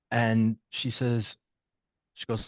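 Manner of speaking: ordinary talk, nothing marked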